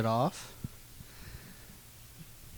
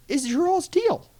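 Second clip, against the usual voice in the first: outraged disbelief; 13 semitones higher than usual. Retold or imitated. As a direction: pitch leaps way up in outrage. Kid outraged at uncles' prank.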